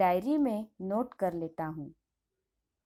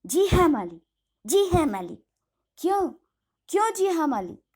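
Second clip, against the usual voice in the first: louder, higher, sputtering mock of her words; angry stammer; 5 semitones higher, 4 dB louder, faster than usual. Master angrily mimicking the governess's meek answer. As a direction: splutter her reply back in a rage. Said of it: "गुस्से से तुतलाते हुए कहते हैं".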